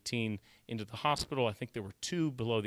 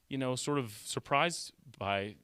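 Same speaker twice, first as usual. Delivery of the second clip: ordinary delivery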